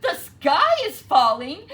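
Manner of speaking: very loud, high alarm cry; cried. A narrator's reading. Henny Penny crying the alarm to Ducky Lucky, loud. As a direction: yell the warning at the top of your lungs. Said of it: "cried Henny Penny".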